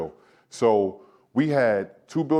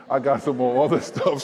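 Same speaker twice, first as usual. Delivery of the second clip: laughing; 3 semitones higher than usual. Real, not acted. Laughing at own spending.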